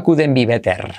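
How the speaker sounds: heavy Spanish accent on couldn't be better; Spanish-accented mispronunciation; wrong Spanish accent. Shown as a demonstration. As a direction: read it as spelt with a Spanish r. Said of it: "pero nunca".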